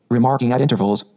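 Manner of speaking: usual speaking voice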